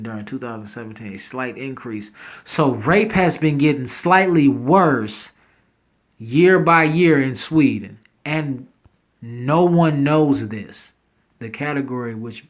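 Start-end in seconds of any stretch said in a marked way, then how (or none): 2.4-10.9 shocked emphasis. Real, not acted. Shocked emphasis on crime stats.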